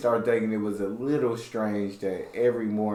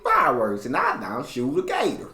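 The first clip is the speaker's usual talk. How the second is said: higher-pitched mock American country accent; imitated country accent; 6 semitones higher than usual. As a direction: mimic the caricature American country twang. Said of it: "people think we talk like that".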